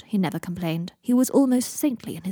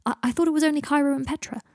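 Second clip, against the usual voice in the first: upset; 7 semitones higher, 4 dB louder than usual. Acted. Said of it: "sounding increasingly upset".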